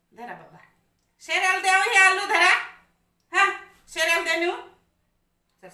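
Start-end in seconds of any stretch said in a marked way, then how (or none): 1.2-2.5 high, loud taunt to the dog; teasing threat. Real, not acted. Teasing threat to give potato away. 3.3-4.6 quick, high, loud taunt; teasing threat. Real, not acted. Teasing threat to give potato away.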